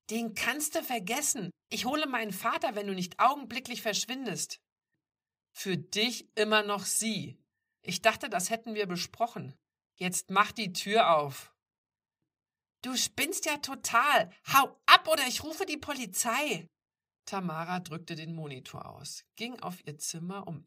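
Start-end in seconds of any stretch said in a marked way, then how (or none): 0.1-4.6 high, loud, quick warning; agitated, raised voice; angry threat. A narrator's reading. Threatens intruder with her father. 5.6-11.5 loud, higher, insistent; stern demand. A narrator's reading. Stranger demands to be let in. 12.8-16.7 high, loud angry outburst; furious, raised character voice; outraged threat. A narrator's reading. Outraged threat to call police.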